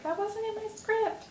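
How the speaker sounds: high mock-flustered squeak; flustered self. Laughing at herself for losing her place, in a high squeak. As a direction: squeak it like a thrown-off reader. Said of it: "I'm like".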